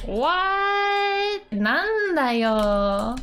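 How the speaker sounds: sudden high startled what; mock surprise, high then flat; surprised what. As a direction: jump up in pitch, startled. Acted surprised quote.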